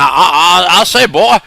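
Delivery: loud, blustering cartoon rooster; Foghorn Leghorn. Showing the guys which chicken he means, loud rooster impression. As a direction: boom it out in a Southern cartoon accent. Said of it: "He sounds like that, that chicken".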